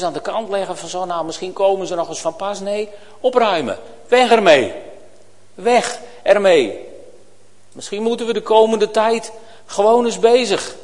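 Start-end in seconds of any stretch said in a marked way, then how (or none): 4.1-4.7 a loud firm order; loud command. Real, not acted. Preacher repeats command for emphasis. 5.5-6.9 slow it down and let it sink in; measured, deliberate repeat; slow emphatic repeat. Real, not acted. Preacher repeats command slowly.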